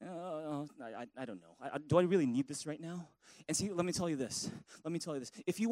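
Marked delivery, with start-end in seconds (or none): 0.0-3.1 much lower, much softer dismissive mumble; mock indifference. Preacher imitating an uninterested listener at church, dismissive mumble. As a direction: brush off the sermon half-asleep.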